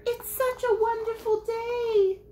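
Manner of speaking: very high, loud lemur voice; sing-song squeak of a tiny lemur; squeaky lemur. Acted. Reading to kids, Lemur cheers about the day.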